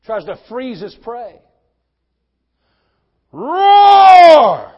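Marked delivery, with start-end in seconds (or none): 3.3-4.7 huge high shouted roar; preacher imitates a lion's roar. Shown as a demonstration. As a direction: roar like a lion to freeze the prey. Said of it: "a lion roars for a reason".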